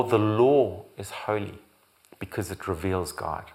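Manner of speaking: ordinary talk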